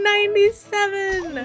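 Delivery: loud, high and drawn out; excited shout. Real, not acted. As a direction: cheer the score at the top of your voice. Live game excitement peak.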